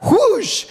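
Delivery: high, loud whoosh; imitated whoosh. Retelling a hammock spin, mimicked high whoosh. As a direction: whoosh like someone being flung round. Imitates being spun round.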